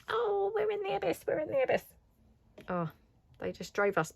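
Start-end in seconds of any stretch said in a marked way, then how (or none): 0.1-1.8 put-on high childish voice, softer; high-pitched childish mock wail, softer; imitating the kids. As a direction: lightly squeal it like the kids. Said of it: "flashbacks of".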